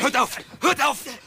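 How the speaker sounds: sharp, urgent command, twice over; urgent intervention. Acted. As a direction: break up a fight with it. Breaking up a knife quarrel.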